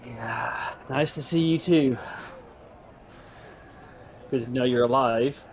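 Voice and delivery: ordinary talk, nothing marked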